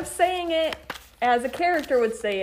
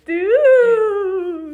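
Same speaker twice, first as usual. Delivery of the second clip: character dude; 8 semitones higher, 6 dB louder than usual. Acted. Game of dude voices.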